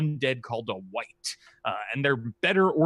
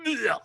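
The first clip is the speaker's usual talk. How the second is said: 1 dragon grunt; 9 semitones higher than usual. Acted. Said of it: "the dragon goes".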